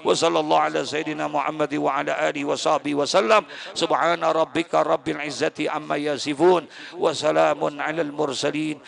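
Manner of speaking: steady chanted Arabic prayer; closing prayer. Acted. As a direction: recite the doa evenly to close. (doa penutup dilantunkan)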